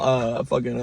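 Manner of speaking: usual speaking voice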